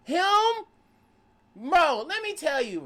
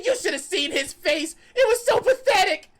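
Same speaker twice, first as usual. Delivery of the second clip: gloating villain; 10 semitones higher, 5 dB louder than usual. Retold or imitated. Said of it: "Oh, she said".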